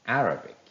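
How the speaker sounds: "Arabic" with a high, loud first syllable AR; correct first-syllable stress. Shown as a demonstration. Teacher models correct pronunciation, stressing AR in "Arabic". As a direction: punch the opening of "Arabic", then let it fall. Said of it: "the correct pronunciation is".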